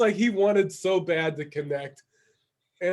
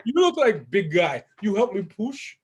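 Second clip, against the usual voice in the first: put-on heavy Russian-accented broken English; Russian impression, lifted at the question; 5 semitones higher, 4 dB louder than usual. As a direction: imitate the Russian asking for help. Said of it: "he's Russian".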